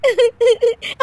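high giggling fit; giggle. Acted. Daughter-in-law giggling at her sore-footed mother-in-law. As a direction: burst into helpless giggles.

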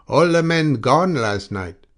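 loud, slow, high call across the yard; hollered news from far off; shouted from afar. A narrator's reading. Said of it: "he shouted from the distance".

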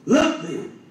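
ordinary talk

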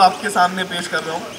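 normal talking voice

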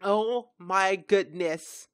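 normal talking voice

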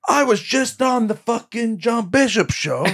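very high, stretched-out bragging impression; Tom Stade. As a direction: crow it in a slow, high put-on voice. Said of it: "you doing an impression of him".

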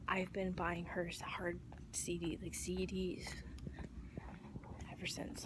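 soft whisper so shoppers don't hear; quiet whisper in a store. Real, not acted. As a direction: keep it hushed in the aisle.

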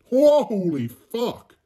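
loud, high, drawn-out disbelief; shocked outburst. Real, not acted. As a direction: blurt it out long and loud, stunned. Shocked reaction to game mishap.

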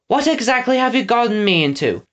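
high, lightly voiced demand; exasperated girl. Winnie bewildered by the escape.

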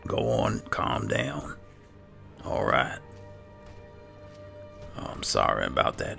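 ordinary talk, nothing marked

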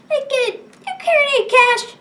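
high, mocking schoolyard bully. Bully shaking down a kid for money, high mock threat. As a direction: shake down the kid for cash, pitched high.

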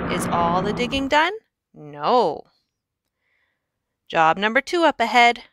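ordinary delivery

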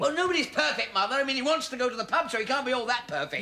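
high-pitched, aggrieved arguing; indignant protest. Acted. Son to mother who praises his cousin, indignant protest. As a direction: argue back, voice pitched high.

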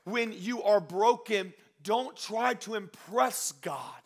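slow, loud, high preacher's exhortation; weighty, drawn-out sermon climax; emphatic preaching. Real, not acted. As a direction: drive each word home to the congregation. Preaches at full force.

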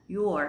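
"your" as one syllable, like the possessive; contracted you're. Shown as a demonstration. Teacher showing the contraction of you are, one-syllable "your". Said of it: "Nghe giống từ của bạn".

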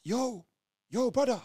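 waking his zombified cellmate; urgent call. Retold or imitated. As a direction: try to rouse him. Trying to wake cellmate.

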